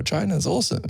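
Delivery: mock gushing. Mock voicing Chinese film message.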